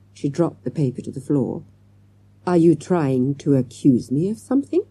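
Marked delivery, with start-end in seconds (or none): none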